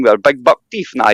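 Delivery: usual speaking voice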